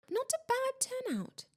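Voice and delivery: high-pitched, pleased understatement; proud. A narrator's reading. Ghost host surveys his party, proud. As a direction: preen a little over the guests. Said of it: "said Nearly Headless Nick proudly".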